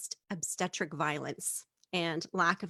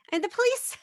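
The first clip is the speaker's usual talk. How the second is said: ordinary talk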